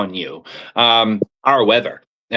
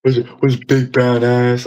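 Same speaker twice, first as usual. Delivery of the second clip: slow, half-hearted Bubbles voice; a light, slow try at Bubbles; Bubbles impression; slower than usual. Reluctant impression for friends, slow and light. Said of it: "I can't do that shit".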